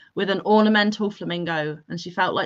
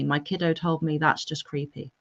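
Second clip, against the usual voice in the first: ordinary talk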